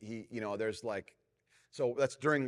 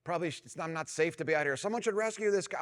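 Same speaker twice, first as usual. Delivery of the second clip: higher, flustered inner panic; self-conscious dad fretting on a surfboard; embarrassed panic; 6 semitones higher, 4 dB louder than usual. Retold or imitated. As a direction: rattle it off like you're mortified. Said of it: "I'm so embarrassed".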